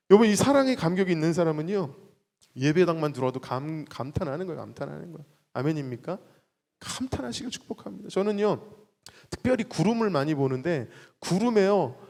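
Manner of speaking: normal talking voice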